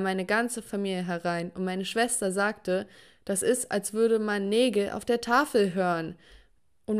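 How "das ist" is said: ordinary delivery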